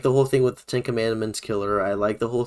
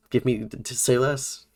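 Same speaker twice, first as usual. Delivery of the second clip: normal talking voice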